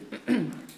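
quick real throat clear. Real, not acted. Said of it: "excuse me".

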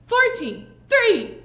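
high, loud called letter; loud game call; shout. A narrator's reading. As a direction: call it out across the room. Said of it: "he shouted out".

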